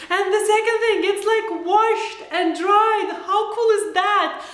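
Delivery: high, stretched gushing delight; excited. Real, not acted. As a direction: gush like it's a miracle. Gushing over washed greens.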